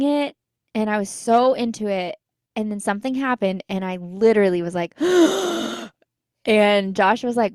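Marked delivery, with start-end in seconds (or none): 5.0-5.9 high sharp retold gasp; retold gasp while reading, pitched up; shocked gasp. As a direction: gasp at a sudden twist. Shocked by a plot twist.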